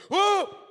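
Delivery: high, loud imitated "whoa"; startled whoop. Biker feeling his back healed, startled whoop. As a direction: whoop out in sudden amazement.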